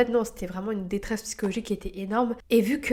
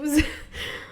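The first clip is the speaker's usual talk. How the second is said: laughing; 6 semitones higher than usual. Real, not acted. Laughs at own remark.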